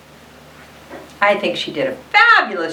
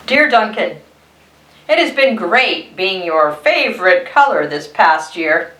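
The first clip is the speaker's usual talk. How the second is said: ordinary talk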